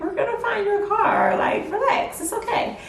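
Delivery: high, laughing mock mom voice, reassuring; soothing mom. Daughter imitating mom soothing her, high and laughing.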